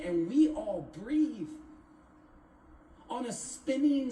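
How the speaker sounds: rhythmic recital climbing in pitch; spoken-word poem, wondering. Acted. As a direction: build the wonder phrase by phrase, stretching key words. Recites own poem, building in wonder.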